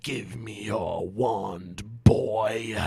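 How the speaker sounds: slow low menacing hiss; hissing Voldemort. Acted. Voldemort demanding Harry's wand, slow low hiss. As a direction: threaten slowly and low. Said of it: "Voldemort hissed".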